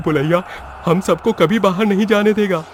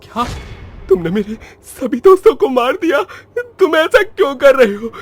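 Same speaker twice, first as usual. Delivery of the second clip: distraught confrontation; 3 semitones higher, 6 dB louder than usual. Acted. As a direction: demand answers from the killer in alarm. Confronting ghost who killed friends. (घबराहट में ऊँची आवाज़ में सवाल)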